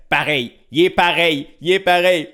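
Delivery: loud, high heckling from the back; loud, high-pitched mock exclamation; imitated heckler. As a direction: call out excitedly, mimicking an amazed onlooker. Acts out woman heckling from behind.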